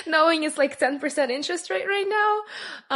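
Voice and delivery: high, loud, straight out of a laugh; real amused disbelief, high and loud; amused exclamation. Real, not acted. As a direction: laugh about it, pitch way up. Laughing about having sold her house.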